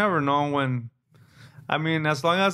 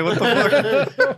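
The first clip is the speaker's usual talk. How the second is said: high, loud and very slow through laughter; laughing disbelief; 8 semitones higher, 5 dB louder, much slower than usual. Real, not acted. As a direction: stretch it out, cracking up in disbelief. Reacting to the prison threat.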